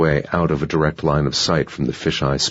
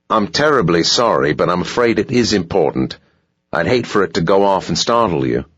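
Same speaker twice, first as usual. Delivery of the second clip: put-on polite hotel clerk in a light South African accent, a bit higher; smooth, dutiful South African manager at the door; a hint of South African, courteous and pitched up; 3 semitones higher, 3 dB louder than usual. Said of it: "South African accent".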